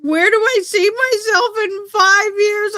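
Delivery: very high, squeaky mock-crying whine; mock whimpering. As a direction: squeal the question as if about to sob. Repeats the question in mock crying.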